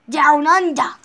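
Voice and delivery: loud, hammed-up Australian accent; terrible Australian accent. Player announcing the Australia map, in a terrible Australian accent. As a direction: put on a bad Australian voice, loudly. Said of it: "that was a terrible Australian voice or accent".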